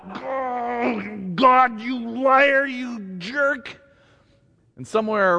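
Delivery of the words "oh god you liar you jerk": higher, crying out, slow; anguished cry. Retold or imitated. A believer crying out a complaint to God. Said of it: "you spew all that out".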